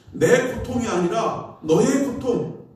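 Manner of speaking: ordinary delivery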